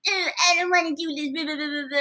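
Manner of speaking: high put-on hulky fighter grumble; a mock fighter grumbling out his lines; wrestler voice. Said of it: "This is my wrestler voice".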